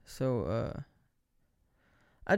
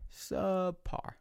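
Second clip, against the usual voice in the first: mock echo; 6 semitones higher, 5 dB quieter than usual. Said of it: "my vision is subpar".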